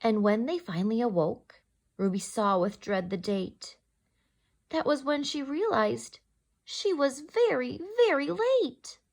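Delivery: gentle rhyming read-aloud; story narration. A narrator's reading. Teacher reading to children in storytime voice. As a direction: read it like a bedtime picture book. Reads picture book aloud.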